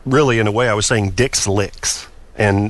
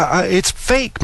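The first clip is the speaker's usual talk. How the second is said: ordinary delivery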